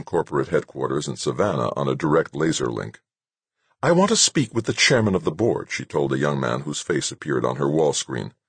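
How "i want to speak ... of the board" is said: higher-pitched, impatient demand; mildly angry and insistent; impatient anger. A narrator's reading. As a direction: demand it with contained irritation. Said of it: "Huffing with impatient anger".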